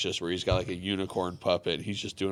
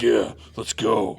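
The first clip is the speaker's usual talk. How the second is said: loud, drawn-out fighting challenge; cocky kangaroo; 5 dB louder, much slower than usual. Retold or imitated. Male kangaroo squaring up to people, loud drawn-out challenge. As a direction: puff up like a jacked male animal. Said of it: "be like".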